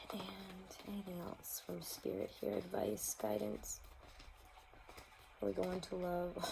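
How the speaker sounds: soft, hushed asking of the spirits; hushed question. Real, not acted. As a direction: ask the unseen quietly, almost whispered. Quietly asking Spirit for guidance.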